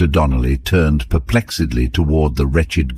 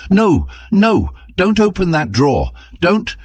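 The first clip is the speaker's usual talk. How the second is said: much higher, panicked warning cry; alarmed shout. A narrator's reading. Husband warning his wife off the drawer, panicked shout. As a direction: stop her hand in terror. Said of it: "and shouted".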